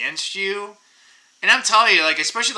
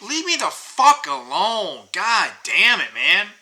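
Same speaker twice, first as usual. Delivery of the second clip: real exasperated outburst, pitched up; pissed off; 5 semitones higher, 3 dB louder, slower than usual. Real, not acted. Ranting about people blocking him on the sidewalk, pissed off. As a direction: vent in fed-up frustration, dragging out the curse. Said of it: "I'm just pissed".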